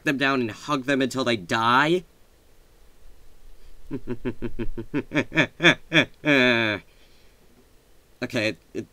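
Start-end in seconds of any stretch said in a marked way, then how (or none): 3.5-6.8 long staccato mock-evil laugh. Laughing over a good idea that sounds evil, mock-evil laugh. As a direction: let out a drawn-out villain cackle. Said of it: "it's not an evil thing".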